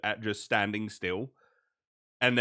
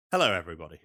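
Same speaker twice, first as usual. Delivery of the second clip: ordinary delivery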